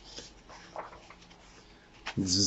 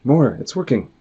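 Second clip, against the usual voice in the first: higher, louder eager shout; excited call; 6 semitones higher, 5 dB louder than usual. Acted. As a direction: cheer them on. Character urging more honey.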